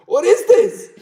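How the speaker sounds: incredulous. Real, not acted. Streamer triggered by an odd chat question, disbelieving. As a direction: squeak out baffled disbelief.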